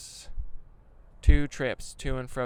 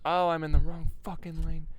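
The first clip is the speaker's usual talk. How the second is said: louder, higher blurt of dismay; real driver's exclamation; sudden alarm; 4 semitones higher, 5 dB louder than usual. Real, not acted. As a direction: blurt it out on spotting your driving mistake. Realizes driving mistake mid-sentence.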